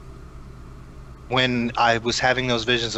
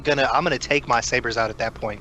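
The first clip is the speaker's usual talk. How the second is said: usual speaking voice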